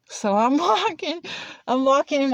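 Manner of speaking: plain reading voice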